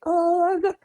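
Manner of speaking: high, nervous, fumbling self on the phone; tongue-tied and nervous. Retold or imitated. Her younger self nervously calling the casting director, high and tongue-tied. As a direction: squeak out a nervous hello. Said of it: "I was so tongue-tied when she answered the phone".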